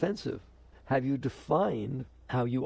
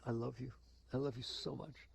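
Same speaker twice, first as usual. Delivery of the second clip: intimate love; 12 dB quieter than usual. Acted. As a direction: say it tenderly and quietly, as in an intimate moment. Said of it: "you're in an intimate moment".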